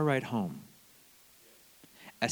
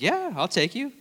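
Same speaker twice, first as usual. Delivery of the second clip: much higher, lilting confirmation; imitated eager assurance; 10 semitones higher than usual. David confirms the ride to the freshman, brightly reassuring. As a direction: confirm the offer with pitch leaping up.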